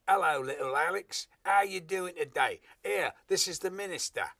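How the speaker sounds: loud, high mock working-class British accent; wrong-class accent. Stuntman miscast as an eminent doctor greets little Alex, mock working-class accent. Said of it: "would have a very upper-class accent".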